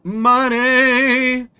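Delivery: loud, gleeful cry of money; greedy shout. Acted. Greedy king exclaims.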